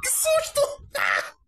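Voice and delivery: high shriek then nervous laughter; startled scream. Real, not acted. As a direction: jump scare, scream and laugh. Jump scare, screams then laughs.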